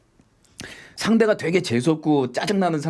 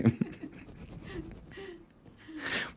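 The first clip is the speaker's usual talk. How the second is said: laughing through the words; can't stop laughing; 17 dB quieter than usual. Real, not acted. Laughing while telling what happened after the crash.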